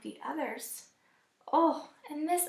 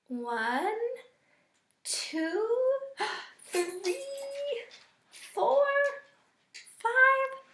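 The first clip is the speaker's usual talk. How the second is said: rising, building to loud, high and thrilled; excited counting; 9 semitones higher, 4 dB louder, much slower than usual. Acted. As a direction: count up bigger and bigger to five. Excited counting of returning ducks.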